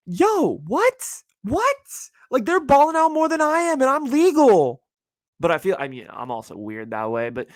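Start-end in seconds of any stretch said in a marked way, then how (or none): 0.1-4.8 high incredulous yelps; stunned disbelief. Real, not acted. Scrolling friends' stories in disbelief, high incredulous yelps. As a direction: squeal it out in disbelief.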